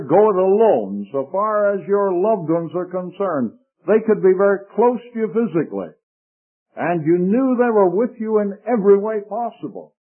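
ordinary talk, nothing marked

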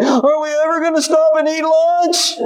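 put-on high, whiny child in the back seat; whiny kid voice. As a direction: whine like a hungry kid on a long drive. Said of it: "kids in the back of the car".

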